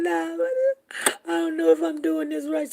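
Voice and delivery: high, loud put-on crying; mock wail. Bride balking halfway down the aisle, mock wail. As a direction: wail it high and long, as an acted protest.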